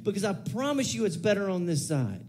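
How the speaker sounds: ordinary delivery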